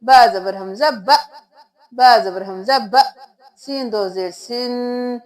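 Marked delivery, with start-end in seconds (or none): 0.0-3.0 "ब" with a sharp stop on the hamza; jerked hamza. Shown as a demonstration. Teacher demonstrates to students the jerk on "ब". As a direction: clip "ब" with a quick catch. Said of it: "झटका दे के पढ़ेंगे".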